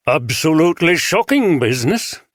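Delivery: put-on a bishop with a light northern English accent. A narrator's reading. Bishop greets the detectives, light northern English accent. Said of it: "pronounced traces of a northern accent".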